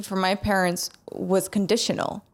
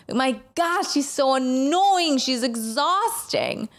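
put-on ranting to a girlfriend, exasperated and higher; 8 semitones higher, slower than usual. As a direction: vent it, slow and overwrought. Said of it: "I was like".

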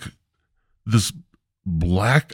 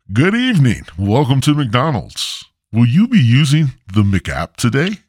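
higher, louder chipper greeting; chipper drive-thru worker; 4 semitones higher, 4 dB louder than usual. Retold or imitated. Comic telling how the drive-thru clerk greeted him, higher and louder. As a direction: play the eager fast food clerk. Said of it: "similar to what, what I heard".

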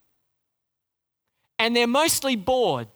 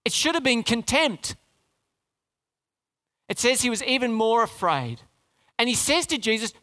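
ordinary talk

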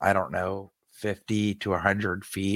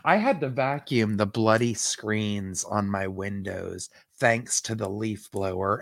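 ordinary talk, nothing marked

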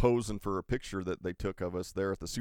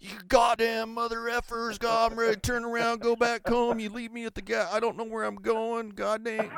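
high-pitched mock angry tirade; freaked-out rant; 12 semitones higher than usual. Lost friend ranting over the walkie-talkie, imitated panic.

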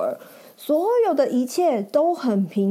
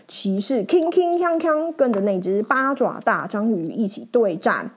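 ordinary delivery, nothing marked

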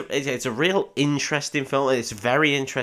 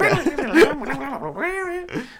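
high wailing mouth-guitar noise; imitated guitar solo. Guitarist told to slay, imitated screaming solo. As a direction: squeal out a wild guitar lick.

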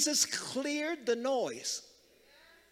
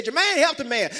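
raised, loud club-style holler; club shout; 7 semitones higher, 8 dB louder than usual. Shown as a demonstration. Preacher leading congregation in a repeat, club holler. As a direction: call it out like you're in the club. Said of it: "Say it like you out in the club".

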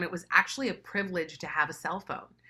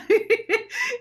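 uncle's high laugh; 7 dB louder than usual. Retold or imitated. Uncle laughing at her answer.

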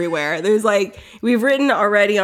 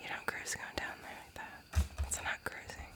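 whispering; 4 semitones higher than usual. Real, not acted. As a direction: whisper it so she can't hear. Whispered aside about silence.

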